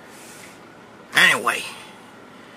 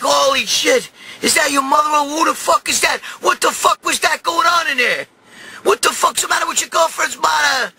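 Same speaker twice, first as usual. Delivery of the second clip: high, shouted pile-up of questions; scandalised disbelief; 8 semitones higher than usual. Retold or imitated. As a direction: act out relatives yelling in shock. Family heckling him in disbelief.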